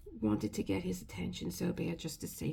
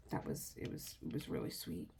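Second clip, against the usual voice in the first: moved whisper; 3 semitones lower, 9 dB quieter than usual. Real, not acted. Moved, voice dropping away.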